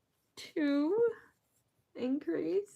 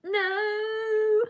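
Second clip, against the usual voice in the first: 1 startled no; 13 semitones higher, 6 dB louder than usual. Real, not acted. Losing count while crocheting.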